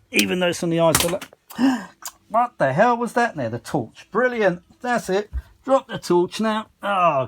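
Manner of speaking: sudden high startled outcry; startled gasp. Real, not acted. Repairman startled by dropped torch, high yelp. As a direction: jump at the crash and yelp about it.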